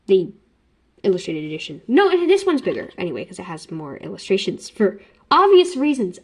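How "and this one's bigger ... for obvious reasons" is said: usual speaking voice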